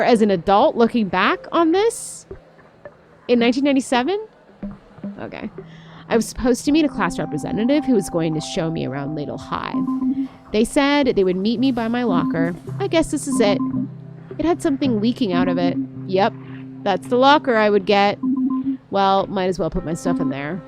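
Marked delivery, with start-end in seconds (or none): none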